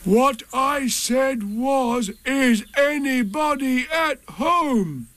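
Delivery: very high, drawn-out, insistent call; calling very loudly. A narrator's reading. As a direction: repeat it slowly, calling hard to be heard. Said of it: "called out Pooh very loudly".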